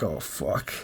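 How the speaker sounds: low, quiet, defeated; deflated muttered curse. Retold or imitated. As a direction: let it drop out under your breath. Police officer brushes him off.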